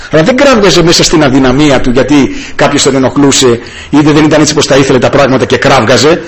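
ordinary talk

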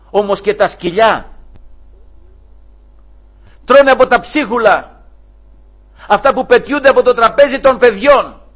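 impassioned plea. Acted. Preacher voicing the mother's plea to the Lord, loud. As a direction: plead with all your heart. Woman's humble answer, preached.

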